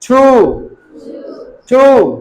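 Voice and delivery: छू with a drawn-out oo; teacher modelling long oo in छू; long OO vowel. Shown as a demonstration. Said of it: "हमें इसको ऐसे pronounce करना है".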